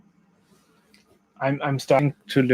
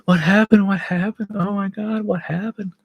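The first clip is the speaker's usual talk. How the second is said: gasp, then high, frantic questions; panicked wife; 9 semitones higher, 3 dB louder than usual. Retold or imitated. Wife frantically asking about the cut, high and panicked.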